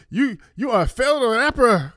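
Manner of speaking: high, loud mimicry of detractors; mocking heckle. Detractors jeering at him as a failed rapper, mocking. Mocking critics in retold spat.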